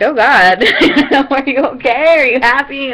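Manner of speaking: husband asks his crying fiancee if she is happy, laughing; laughing concern. Retold or imitated. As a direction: ask if she's okay, half-laughing. Husband comforting her in tears.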